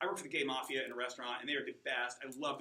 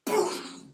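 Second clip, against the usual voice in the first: sharp unvoiced high burst for a punch; punch sound; 9 dB louder than usual. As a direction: spit out the punch noise like a hit.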